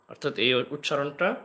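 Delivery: normal talking voice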